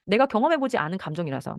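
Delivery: ordinary talk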